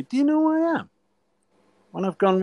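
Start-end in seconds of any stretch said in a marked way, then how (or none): 0.0-0.9 high-pitched mock outrage; indignant rank-pulling. England captain to new referee, mock outraged. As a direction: pull rank, voice shooting way up.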